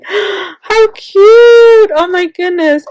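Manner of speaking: high, loud, slow gushing squeal; overwhelmed with delight at a gift. Real, not acted. Crafter opening a gift box, squealing with delight.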